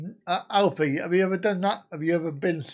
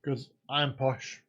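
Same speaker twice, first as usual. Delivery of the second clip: mock posh; 3 semitones lower, 6 dB quieter, slower than usual. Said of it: "I'm faux posh".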